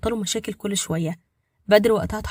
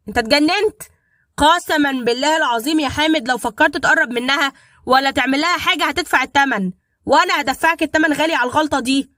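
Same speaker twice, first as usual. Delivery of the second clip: raised, furious threat, higher and louder; 5 semitones higher, 6 dB louder than usual. A narrator's reading. Badr threatening Hamed on the phone, furious. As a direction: threaten him with rising fury. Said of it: "فقال بغضب شديد".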